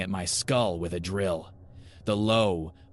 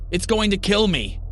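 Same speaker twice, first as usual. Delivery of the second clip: high, loud panicked shout; desperate cry; 13 semitones higher, 8 dB louder than usual. A narrator's reading. Child dragged by mom toward the deadly building's door, desperate cry. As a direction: cry out in terror for your life. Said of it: "I cried".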